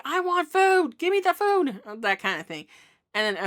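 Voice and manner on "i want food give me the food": high, louder demanding dog voice; the dog's voice, much higher, pushy; voicing the dog. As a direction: speak for a hungry dog begging for food. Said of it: "She's basically saying".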